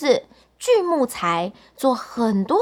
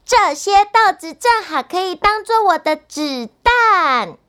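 put-on higher, louder, delighted little boy; 6 semitones higher, 5 dB louder than usual. A narrator's reading. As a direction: crow happily as an excited boy. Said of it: "高兴地说". (高兴又响亮的小男孩声音)

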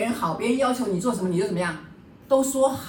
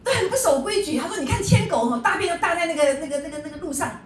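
indignant stranger; 8 semitones higher than usual. Retold or imitated. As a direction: imitate a grumpy passer-by complaining about dog owners.

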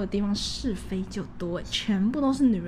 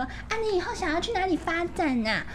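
fake friendly coworker; 8 semitones higher than usual. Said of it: "然后就问我说".

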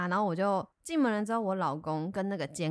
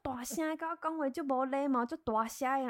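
imitating neighbor; 5 semitones higher than usual. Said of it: "他就是讲台语".

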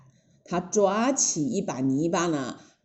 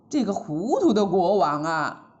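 ordinary delivery, nothing marked

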